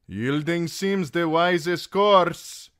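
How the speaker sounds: high carrying voice; calling to her. A narrator's reading. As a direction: call advice across a distance. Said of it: "he called to Arianne".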